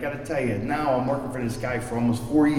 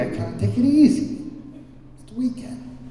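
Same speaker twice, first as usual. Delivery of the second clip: mock lazy attitude; 9 semitones higher than usual. Mocking his lazy Friday attitude.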